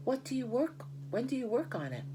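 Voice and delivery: high, pleading rush of questions; begging. A narrator's reading. Wilbur pleading with Charlotte about her plan, high and eager. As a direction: beg her for an answer. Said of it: "begged Wilbur".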